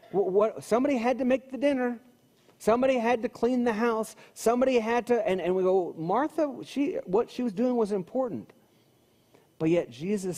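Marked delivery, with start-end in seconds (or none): 0.1-5.3 higher, faster, indignant protest; pleading Martha's case in mock outrage; defending Martha's chores, mock indignant and higher. 6.0-8.5 higher-pitched insistent defense; mock protest. Voicing defense of Martha.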